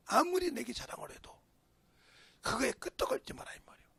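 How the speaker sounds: normal talking voice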